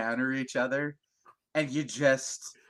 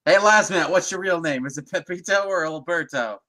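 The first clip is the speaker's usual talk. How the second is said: loud call across the room; raised voice calling a friend over; 5 semitones higher, 8 dB louder than usual. Real, not acted. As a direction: shout the name to get his attention.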